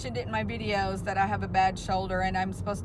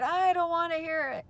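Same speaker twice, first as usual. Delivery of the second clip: much higher, a mock yell; retold scream; 8 semitones higher than usual. Mom yells over her daughter's excuse, in a mock high yell. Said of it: "And she starts screaming at me".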